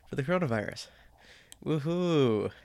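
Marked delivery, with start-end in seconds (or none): 1.6-2.5 sarcastic cheer. Acted. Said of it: "Yeah, no, it's not great".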